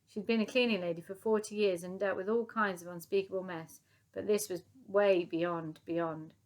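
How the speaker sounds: plain reading voice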